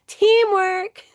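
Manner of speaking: high, loud mock rallying cry; sing-out cheer, hammed-up; playful cheer. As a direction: call it out like a team slogan. Playful cheer, laughs after.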